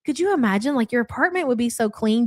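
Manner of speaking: normal talking voice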